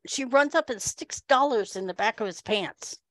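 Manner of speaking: ordinary delivery, nothing marked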